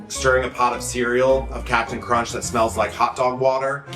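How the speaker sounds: ordinary talk, nothing marked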